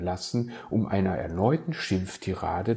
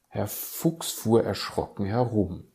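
plain reading voice

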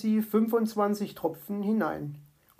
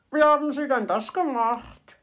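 high, loud amazed bird voice; 6 semitones higher, 5 dB louder than usual. A narrator's reading. Little bird at the fox's trick, amazed. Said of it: "staunte Zwitschi".

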